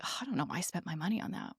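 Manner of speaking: soft, quick note of regret; regretful aside. Retold or imitated. Voicing someone who spent to impress others, soft regret. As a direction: say it low-key and hurried, regretting it.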